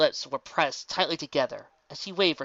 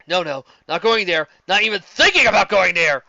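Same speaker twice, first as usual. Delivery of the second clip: loud, stern, talking himself out of it; loud flustered self-rebuke; stern self-scolding; 5 semitones higher, 11 dB louder than usual. Acted. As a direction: bark a firm no at yourself. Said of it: "declared to himself sternly".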